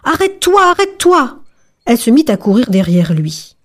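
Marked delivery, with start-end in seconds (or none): 0.0-1.4 put-on old woman calling out. A narrator's reading. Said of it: "la petite vieille cria".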